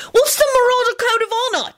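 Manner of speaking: put-on very high, fast childlike burst; excited outburst. A narrator's reading. Timothy bursting out with a question, excited. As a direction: blurt it out, bursting with curiosity. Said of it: "burst out excitedly".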